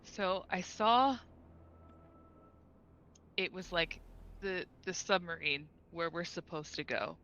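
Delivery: halting, with long searching pauses; shaken, a little scared. Acted. Character reporting a frightening vision, hesitant and mildly scared. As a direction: recount it haltingly, understated unease. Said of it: "and just goes".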